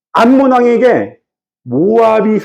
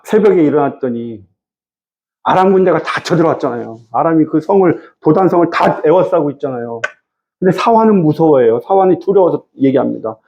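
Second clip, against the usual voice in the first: normal talking voice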